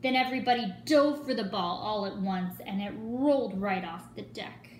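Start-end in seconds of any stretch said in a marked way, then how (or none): none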